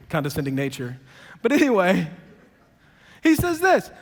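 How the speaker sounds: usual speaking voice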